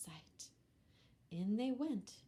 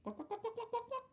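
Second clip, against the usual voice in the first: very high rising clucks; hen clucking; much faster than usual. Acted. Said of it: "said Hen".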